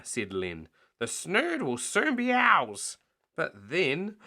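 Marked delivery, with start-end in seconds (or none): 1.0-3.0 high eager crook voice. Acted. Len in the tunnel, high eager voice. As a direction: gloat in anticipation.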